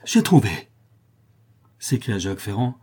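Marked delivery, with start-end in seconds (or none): 0.0-0.5 high, loud triumphant cry; explosion of joy. A narrator's reading. Notary suddenly finding the proof, explosive joy. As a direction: burst out with sudden elation. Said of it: "avec une explosion de joie impossible à rendre".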